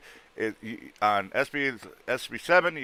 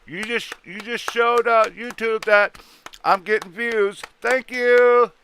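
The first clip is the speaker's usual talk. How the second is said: sarcastic thanks; 11 semitones higher, 4 dB louder, much slower than usual. Real, not acted.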